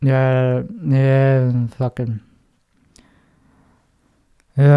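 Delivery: low, soft, bored mumble; deadpan real voice. Shown as a demonstration. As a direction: drag it out like nothing matters. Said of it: "do my real voice".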